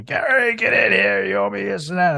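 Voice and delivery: high, calling-out voice from a bar; mock bar-room shout to come in; retold yelling from bars. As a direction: holler him in like a drunk buddy. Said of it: "yelling at me from inside the bars going".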